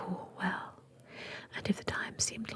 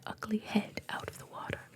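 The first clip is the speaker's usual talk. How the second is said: ordinary delivery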